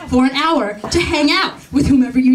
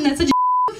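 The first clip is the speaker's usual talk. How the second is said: far higher, indignant scolding cut short; outraged squeal. Retold or imitated. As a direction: rise to a furious peak, then break off.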